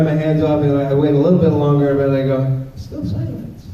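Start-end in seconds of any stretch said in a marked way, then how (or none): 2.8-3.7 whispered check. Retold or imitated. Calling out, listening for kids.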